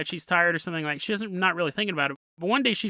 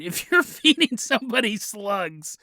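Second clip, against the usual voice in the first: ordinary talk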